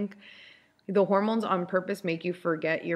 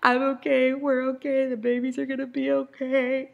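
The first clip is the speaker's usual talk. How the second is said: high, pitched-up mock-brave reassurance; teary reassurance; 7 semitones higher than usual. Crying mom reassuring her husband in a high voice. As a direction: insist everything's fine while falling apart, higher. Retelling her own tearful meltdown.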